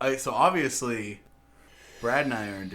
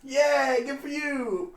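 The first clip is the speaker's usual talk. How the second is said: high pet praise; 12 semitones higher, much slower than usual. Real, not acted. Praising the dog Omega.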